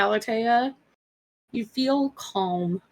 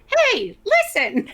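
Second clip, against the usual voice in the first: much higher, louder mock call; hammed-up high hey listen; imitating Lucy Ellis; 11 semitones higher, 4 dB louder, slower than usual. Player mocks the character's call, much higher and louder. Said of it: "Lucy Ellis is like".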